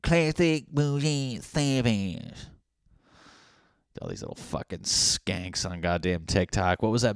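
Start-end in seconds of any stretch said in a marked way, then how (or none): 0.0-2.3 high, very slow, stretched out; drawn-out catchphrase. Pretend. Reading a TikTok caption aloud, slow drawn-out drawl. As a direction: savor each word like a catchphrase.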